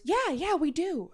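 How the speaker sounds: high-pitched imitation of an employee; mocking, high reassurance; mock manager reply. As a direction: insist lightly, voice jumping up. Imitating the manager's defensive answer.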